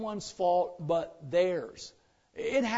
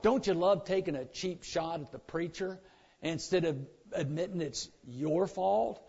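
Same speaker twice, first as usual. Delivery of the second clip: normal talking voice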